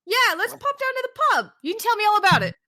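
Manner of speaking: high, hammed-up British voice; chirpy Brit inviting a mate; British accent. Mocking UK Brian.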